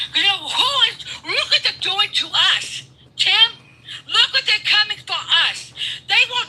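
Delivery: high-pitched heated yelling; furious rant; angry shout. Real, not acted.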